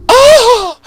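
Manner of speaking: high scream like a little bitch; shriek. Retold or imitated. Spike remembering everything, shrieking high. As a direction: shriek in high-pitched terror. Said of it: "And he's like".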